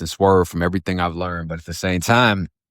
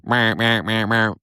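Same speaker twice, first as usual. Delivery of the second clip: imitated phone noise; 3 semitones higher, slower than usual. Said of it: "It goes".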